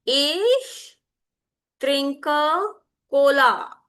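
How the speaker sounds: word by word, with a clear pause after "Ich"; slow for repeating. Shown as a demonstration. Teacher has students repeat after her, speaking slowly. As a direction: stretch out "Ich trinke Cola" slowly for learners. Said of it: "I'll speak slowly".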